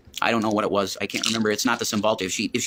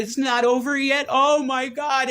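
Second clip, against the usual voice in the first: high, drawn-out mock wail; imitated distraught woman; 13 semitones higher, slower than usual. Man imitating partner refusing the breakup, high slow wail. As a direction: stretch it out high like her protest. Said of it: "And she said".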